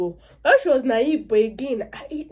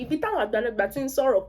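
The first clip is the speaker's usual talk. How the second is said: plain reading voice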